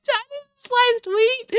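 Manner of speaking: high, slow disbelief; can't believe the result; drawn-out high-pitched outrage; incredulous. Real, not acted.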